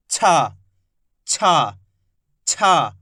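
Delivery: छा with a sharp puff of air, repeated; aspirated chh demo. Shown as a demonstration. Teacher repeating छा for students to copy. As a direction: breathe out hard on छा. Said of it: "कैसे उच्चारण हो रहा है?".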